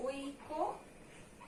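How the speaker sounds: ঐকতান stretched out as a teaching model; slow model pronunciation. Shown as a demonstration. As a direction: model ঐকতান very slowly with the o after k. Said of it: "ওকার যোগ হবে".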